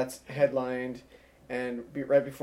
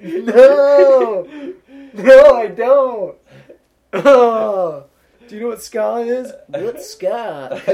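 laughing shout; 8 semitones higher, 16 dB louder, much slower than usual. Retold or imitated. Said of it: "And I'm like".

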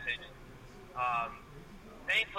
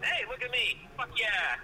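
higher-pitched, self-mocking cheer; playful boast; 7 semitones higher, slower than usual. Real, not acted. Swearer now on a podcast, gleefully boasting. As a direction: show off gleefully.

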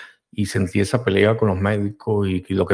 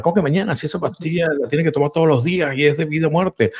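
higher pitched, pushing back in disbelief at the word mañana; retold outrage, insisting the pill can't wait; indignant protest; 6 semitones higher than usual. A son arguing with the nurse over his mother's pill, indignant. Retold fight with clinic staff.

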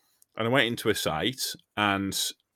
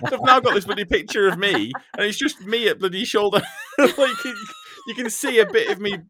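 laughing; 13 semitones higher, 5 dB louder than usual. Real, not acted. Laughing at own photo.

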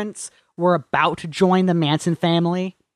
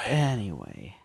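much lower, softer anyway; low quiet mumble; 10 semitones lower, 10 dB quieter, slower than usual. Pretend.

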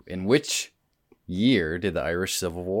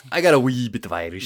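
mock Irish lilt; 3 semitones higher, faster than usual. Echoes in put-on brogue.

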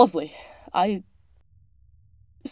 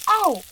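loud, high "ow" of pain; sudden yelp from a burn; 11 semitones higher, 8 dB louder than usual. Acted. Plant tender burnt by her acid mixture, pained yelp.

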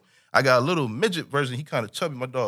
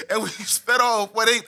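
normal talking voice

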